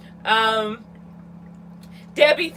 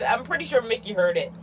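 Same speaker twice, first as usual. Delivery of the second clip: normal talking voice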